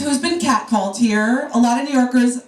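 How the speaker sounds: ordinary talk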